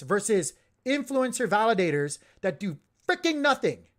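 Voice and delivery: worked up, punching out key words loudly; heated. Real, not acted. Streamer defending an overlooked validator team, heated. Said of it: "I'm so heated right now".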